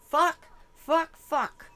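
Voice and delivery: loud high cursing burst; alarmed cursing. Real, not acted. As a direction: blurt it out in alarm. Alarmed outburst.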